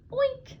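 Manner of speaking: squeaky high pig oink; silly high oink. Acted. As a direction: oink like a piglet. Dog makes pig sound.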